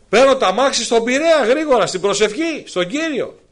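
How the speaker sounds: high, loud ecstatic sermon; preacher shouting his joyful testimony; full-force preaching. Acted. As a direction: preach the joy at the top of your voice.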